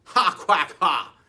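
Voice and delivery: loud quacking gloat; cried the duck. Acted. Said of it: "cried the duck".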